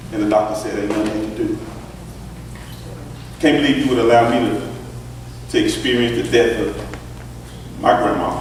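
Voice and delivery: ordinary talk, nothing marked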